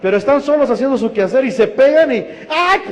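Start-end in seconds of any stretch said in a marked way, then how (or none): 2.5-2.8 sharp high yelp, a hammed-up ouch; mock pained ay, pitched up high; sudden yelp of pain. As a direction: yelp like you just got hurt. Hurts himself while doing chores.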